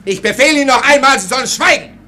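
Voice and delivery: furious rant. Acted. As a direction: fire off the orders in a rage. Officer orders servant to shut up.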